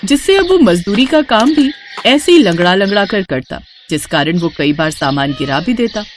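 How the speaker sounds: ordinary delivery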